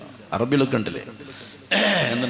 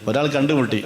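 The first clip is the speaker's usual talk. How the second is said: usual speaking voice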